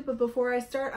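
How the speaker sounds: ordinary delivery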